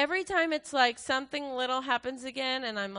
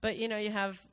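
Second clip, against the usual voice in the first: ordinary talk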